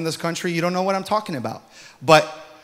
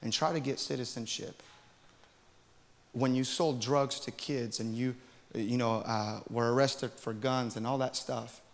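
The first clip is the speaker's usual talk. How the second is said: softer, cold accusation; quiet stern rebuke; 3 semitones lower, 10 dB quieter than usual. Retold or imitated. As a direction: scold him in a low, hard voice. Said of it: "And she says".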